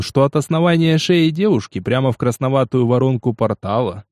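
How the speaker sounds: ordinary delivery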